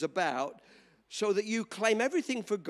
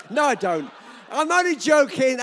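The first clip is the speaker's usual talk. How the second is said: laughing shout; 3 semitones higher, 7 dB louder than usual. Real, not acted. Laughing retraction of joke.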